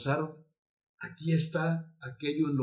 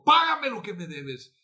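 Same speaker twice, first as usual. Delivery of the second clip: louder, higher demand; 7 semitones higher, 7 dB louder than usual. Acted. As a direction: demand the money. Master demands payment in parable.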